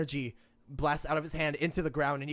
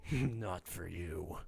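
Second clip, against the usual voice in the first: low, very slow and softer; menacing refusal; 11 semitones lower, 8 dB quieter, much slower than usual. Acted. The captive coldly refuses to open the gate for them. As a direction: drag it out low and cold.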